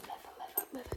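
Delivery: soft, unvoiced whisper; whispered trigger word. Shown as a demonstration. ASMR host introducing leather tapping, whispered.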